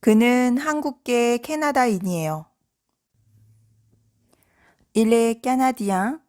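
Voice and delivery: ordinary delivery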